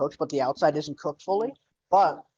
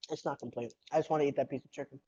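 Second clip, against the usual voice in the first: mouth full; 8 dB quieter, faster than usual. Real, not acted. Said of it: "you'll probably hear me eating this entire time".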